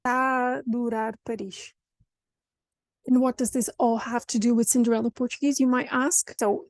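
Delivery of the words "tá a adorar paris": "tá a adorar" as "taadorar", the A held; linked "tá a adorar Paris", long aa; longer open A. Shown as a demonstration. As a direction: stretch one open A through TÁ A ADORAR. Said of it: "slightly longer A".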